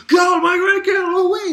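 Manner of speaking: high, loud shoved-aside yell; brute shouting. Retold or imitated. A huge brute barging past Ichigo, shouting high and loud. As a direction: bellow at him to move. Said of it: "this huge thing coming saying".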